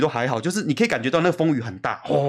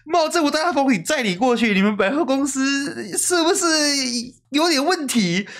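higher, slow, exasperated disbelief; mock outrage at her company, pitched up; retold angry thought; 10 semitones higher, slower than usual. Recalling his silent fury on the typhoon trip, high exasperated voice. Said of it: "我就想说".

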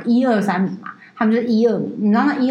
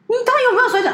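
indignant outburst; 12 semitones higher, much faster than usual. Real, not acted. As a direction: fling the question out in outrage. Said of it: "angry rant, same question".

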